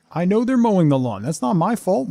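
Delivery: normal talking voice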